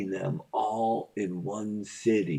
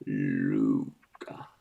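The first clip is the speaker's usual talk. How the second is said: very low booming call; deep voice; 13 semitones lower than usual. Pretend. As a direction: drop it way down low. Deep voice into fan.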